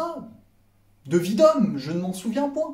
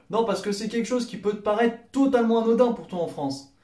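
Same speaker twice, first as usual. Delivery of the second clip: ordinary delivery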